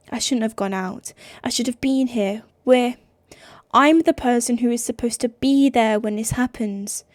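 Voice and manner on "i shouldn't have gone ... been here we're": usual speaking voice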